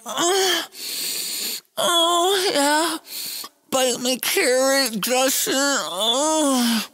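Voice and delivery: high moaning woman voice, hammed-up. Comic storyteller voicing a moaning prostitute, high hammed-up moans. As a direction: moan it high and breathy-free like a mock lover.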